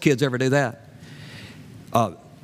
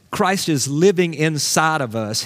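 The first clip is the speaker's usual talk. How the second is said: usual speaking voice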